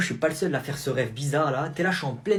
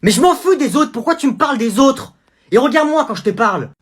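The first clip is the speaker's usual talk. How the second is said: loud, higher furious mom; angry mother; 10 semitones higher, 10 dB louder than usual. Acted. Mother yelling at her son over his grade. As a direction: demand an answer about the grade.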